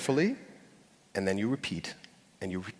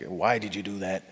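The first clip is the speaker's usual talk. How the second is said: incredulous; 3 semitones lower, 5 dB quieter than usual. Retold or imitated. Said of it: "something incredulous".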